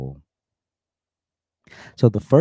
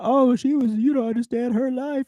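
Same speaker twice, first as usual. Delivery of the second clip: mocking quote; 14 semitones higher than usual. Mocking defenders of the girl.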